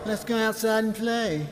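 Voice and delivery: high, small-voiced playground mimicry; taunting, high-pitched copycat voice; mocking echo. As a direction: parrot it back to get under their skin. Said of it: "this idea of mocking".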